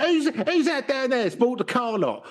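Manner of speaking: very high, squawking soap character; mock EastEnders local. Local gossips about the Russian newcomer, squawky EastEnders voice. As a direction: caricature a nosy soap gossip.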